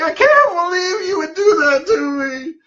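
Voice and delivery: very high, wailing mock yell; imitated tantrum yell. Angry boy to therapist who unplugged his Xbox, high mock tantrum. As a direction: wail it like an outraged kid. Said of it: "he starts yelling".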